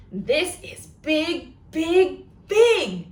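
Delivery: big and growing. Shown as a demonstration. Librarian showing big to children, booming it. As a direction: swell up bigger on every big. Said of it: "And it goes like this".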